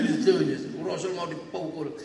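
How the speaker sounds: ordinary talk, nothing marked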